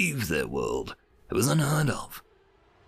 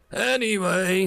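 gruff; 9 semitones higher, 4 dB louder than usual. A narrator's reading. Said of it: "he continued gruffly".

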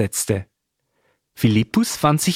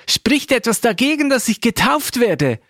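high-pitched, lightly acted eager question; eager calling out; 10 semitones higher than usual. A narrator's reading. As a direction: ask it eagerly, pitched well up, same volume. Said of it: "rief der Äthiopier". (hohe, leicht gespielte, drängende Frage)

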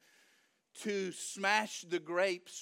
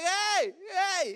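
high, loud hey from above; angel calling out; 10 semitones higher, 5 dB louder than usual. Acted. Angel peering over the winepress at Gideon, calling out. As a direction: call down to get their attention.

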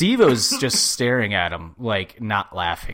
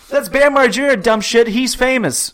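high, loud acted-out yell; mock yelling; 13 semitones higher, 8 dB louder than usual. Co-host replaying the prank's reveal, mock yelling.

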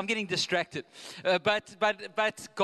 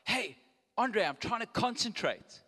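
annoyed golfer; 5 semitones higher than usual. Retold or imitated. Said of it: "this guy was like".

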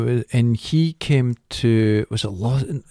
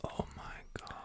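whispered; 26 dB quieter, faster than usual. Real, not acted. Horrified reaction.

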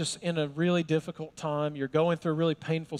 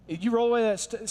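normal talking voice

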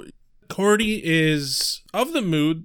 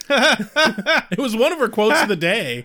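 laughing; 4 semitones higher, 3 dB louder than usual. Real, not acted. Laughing after the quote.

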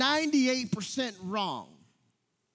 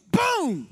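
much higher, louder mock "Boom!"; toddler's punch noise; 12 semitones higher, 6 dB louder than usual. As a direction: punch out a high, loud "Boom!" like an angry toddler. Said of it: "he goes".

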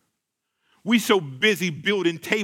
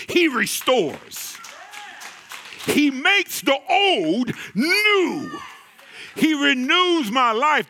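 preaching build; 6 semitones higher, slower than usual. Acted.

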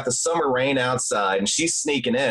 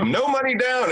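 ad pitchman voice; 12 semitones higher than usual. Retold or imitated. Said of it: "It's like".

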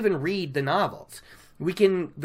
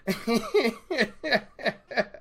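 a real burst of laughter, pitched up; amused laugh; 6 semitones higher than usual. Real, not acted. Laughing at the innkeeper's understatement, real amused laugh. Laughing at Fitch's dry remark.

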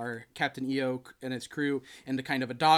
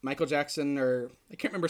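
ordinary talk

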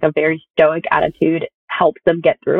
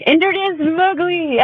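grand announcement; 11 semitones higher, much slower than usual. Retold or imitated. Announcing the new puppy.

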